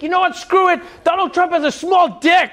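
snapping. Retold or imitated. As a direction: finally snap and blurt it out. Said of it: "he snapped".

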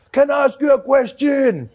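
loud, high-pitched put-on elder voice; hammed-up plea to the officer; grandfather addressing policeman. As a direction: call it out as the old grandfather. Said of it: "my grandfather turns to the cop".

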